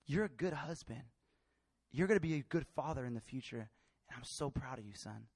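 low, soft, sinking almost to a hush; gentle imagined dad voice; tender fatherly affirmation. Retold or imitated. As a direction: reassure a son quietly and sincerely.

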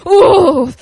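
big, loud blown-out "ooh"; exaggerated sigh. Asked about being ready to minister, exaggerated sigh. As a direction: huff out an overwhelmed sigh, hammed up. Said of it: "by their sighs".

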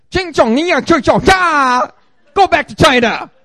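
high, loud mock-Chinese jeering; mocking taunt. Preacher imitating those who made fun of him, loud taunt. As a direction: jeer it loudly in exaggerated singsong tones. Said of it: "used to make fun of me".

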